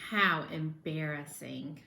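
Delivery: low, slow wince; a sympathetic cringe; embarrassed aside. A narrator's reading. Narrator comments on the clumsy landing, low wince.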